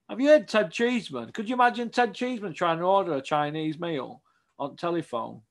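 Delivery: usual speaking voice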